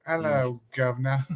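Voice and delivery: put-on soft, restrained British accent; toned-down British. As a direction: dial the British impression right back. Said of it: "dial it back a little bit".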